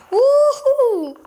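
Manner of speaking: high loud whoop; excited whoop. Real, not acted. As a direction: whoop in triumph. Celebrating kills.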